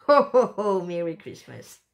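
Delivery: loud, booming ho-ho-ho, then settling; mock Santa greeting, big burst then easing off; playful Santa laugh. Admiring the Christmas albums, playful Santa ho-ho-ho.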